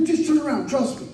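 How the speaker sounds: high, urging imitation; retold insistence. Friend urging the driver to turn, retold insistence. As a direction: press the driver to go along.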